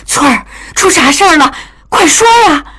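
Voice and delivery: high, urgent and worried voice; anxious mother. A narrator's reading. Mother anxiously presses the weeping maid for news. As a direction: press her hard, frightened for news. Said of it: "江母也急了".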